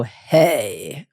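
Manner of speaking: forced, unnaturally low Hey; Elizabeth Holmes. Retold or imitated. Coach mocks Elizabeth Holmes' faked deep voice, low. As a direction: fake a deep baritone Hey. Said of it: "I want you to be like Elizabeth Holmes".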